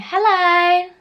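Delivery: high, loud singsong hello; best friend's greeting. Retold or imitated. Friend calling hello as she walks in, high and loud. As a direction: call out a hello as the arriving friend. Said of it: "I hear my best friend Talia's voice".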